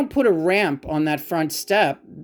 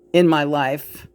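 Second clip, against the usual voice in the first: normal talking voice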